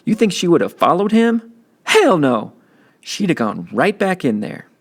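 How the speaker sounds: big brother setting him straight; teen big brother. Acted. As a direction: talk down to your kid brother, tough but caring. Byron driving home a point.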